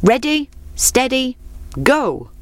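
high, sing-out starter's call. A narrator's reading. Fox to the racers at sunrise, calling out. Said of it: "the fox called".

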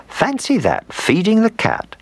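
high, delighted amazement; marvelling. A narrator's reading. Old woman finds dog feeding the cat, high and marvelling.

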